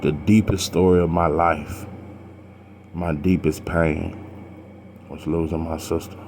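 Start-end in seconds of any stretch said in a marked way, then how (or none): 2.9-6.2 quiet grief. Real, not acted. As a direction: let the pain pull the voice down. Naming his deepest pain.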